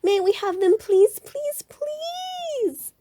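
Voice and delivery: high pleading, rising to a squeaky last please; begging cubs. A narrator's reading. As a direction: beg harder on each please.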